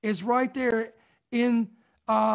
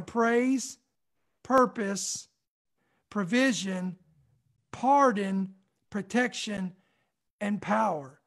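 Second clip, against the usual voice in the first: slow list with a long pause after each item; one item at a time, well spaced out; said slowly; 2 semitones lower, slower than usual. Shown as a demonstration. Preacher breaking down the six Ps for listeners, slowly. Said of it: "let me say that slowly".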